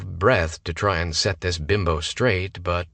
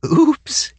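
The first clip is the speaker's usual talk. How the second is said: giggling; 9 dB louder than usual. A narrator's reading. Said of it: "she giggled".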